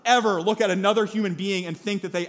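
loud, driving sermon delivery; forceful preaching. Real, not acted. Preacher urging never to write anyone off, forceful preaching. Preacher drives home the point.

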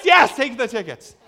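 high, loud retold urging; hammed-up exasperated yes; emphatic insistence. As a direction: shout it to settle the matter. Acted final insistence to mom.

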